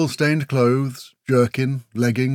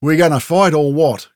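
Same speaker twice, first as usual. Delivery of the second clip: raised, louder challenge; confused challenge; 4 semitones higher, 6 dB louder than usual. A narrator's reading. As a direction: demand a fight, a touch confused.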